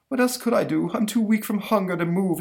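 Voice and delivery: high, theatrical self-pity; put-on faint-with-hunger lament; Doctor Smith. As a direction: whine weakly like a melodramatic ham. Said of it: "He totally is Doctor Smith from".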